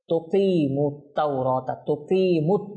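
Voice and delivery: slow recited তুকিমুত with a long "qee"; one-alif madd. Shown as a demonstration. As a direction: stretch the middle vowel of তুকিমুত. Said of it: "এটা এক আলিফ মদ করব". (তুকিমুত-এর কি এক আলিফ টেনে)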